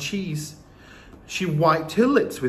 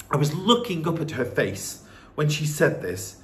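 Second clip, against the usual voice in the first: usual speaking voice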